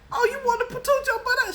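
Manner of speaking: high, loud mocking taunt; mock challenge. Mocking the sixty-year-old who flashed her sister, high and loud. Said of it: "mock challenge to the grandma".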